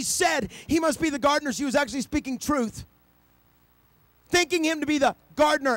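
high, loud, driving preacher's cadence; preaching at full force. Acted. Preacher crying out to the congregation about resurrection, full force. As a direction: build the sermon to its peak.

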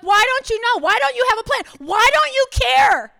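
high, loud, frustrated yelling. Retold or imitated. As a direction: hammer every why at him. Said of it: "I mean, I'm just yelling at him".

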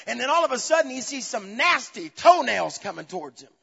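high, loud, driving sermon delivery; fervent preaching. Acted. Preacher tells congregation of the disciples finally reaching shore, fervent preaching. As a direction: preach it with rising force.

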